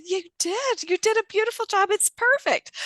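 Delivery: high-pitched gushing encouragement; delighted praise. Retold or imitated. Trainer congratulating nurse on her breakthrough, delighted.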